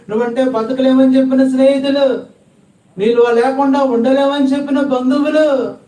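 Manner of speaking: high, loud preacher's cry to the crowd; fiery preaching. Acted. Preacher urging the congregation, in a high, loud cry. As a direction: preach it with rising fervour. Preacher rises to full force.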